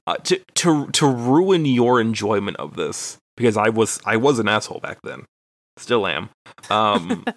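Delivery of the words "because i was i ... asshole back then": normal talking voice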